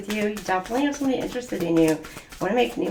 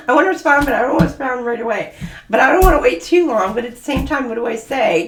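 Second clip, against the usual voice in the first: put-on flustered teen; 3 semitones higher, 6 dB louder, faster than usual. Anxious teen deciding how to reply.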